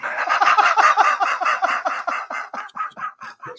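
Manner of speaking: high, breathless, squealing laugh; burst of laughter. Real, not acted. [name] laughs at his own joke about a good woman, high wheezing laughter.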